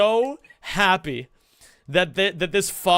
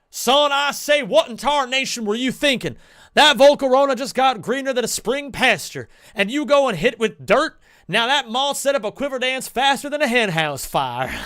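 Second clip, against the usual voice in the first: Foghorn Leghorn voice; 6 semitones higher, 5 dB louder than usual. Retold or imitated. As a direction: scold the player like an old-timey rooster, raised pitch. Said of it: "the caption reads".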